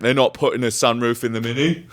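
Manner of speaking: imitating dad in a London accent. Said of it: "You're doing London accent".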